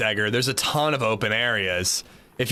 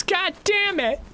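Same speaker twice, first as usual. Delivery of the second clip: put-on baby voice. Cursing in the baby voice.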